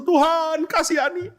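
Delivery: high-pitched mock lament; crying out. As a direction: cry out to God like wailing mourners. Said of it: "mereka teriak, mereka berseru". (berseru meratap, nada tinggi)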